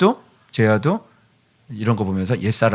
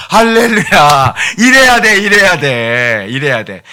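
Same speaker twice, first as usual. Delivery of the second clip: loud, high hallelujah cheer; exultant, high-pitched approval easing at the end; jubilant praise; 5 semitones higher, 6 dB louder, slower than usual. Real, not acted. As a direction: celebrate it loudly, drawing the words out. Praising a child who stopped fighting.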